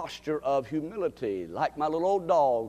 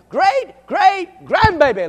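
Great, great grandbaby stretched slow, much higher and louder; emphatic correction; 15 dB louder, slower than usual. Real, not acted. Preacher correcting Joyce's grandbaby count, emphatic. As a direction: hammer out each great in Great, great grandbaby. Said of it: "let me get it right".

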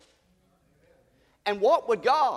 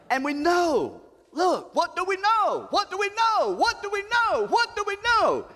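high, ringing call of what do we know; rising preacher cry; 12 semitones higher than usual. Acted. Preacher calling out to the church, high and ringing. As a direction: lift the congregation with a soaring cry.

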